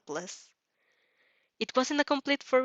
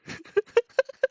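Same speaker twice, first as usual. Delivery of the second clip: high squealing laugh; high giggling shriek; 7 dB louder than usual. Real, not acted. Reader laughs at the blood request.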